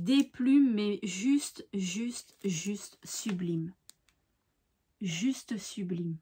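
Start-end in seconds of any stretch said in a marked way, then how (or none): 1.7-6.2 slow whisper, lost in admiration; awed whisper. Real, not acted. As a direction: let it fall to a breathless whisper. Marvelling at feathered card. (murmure émerveillé, lent)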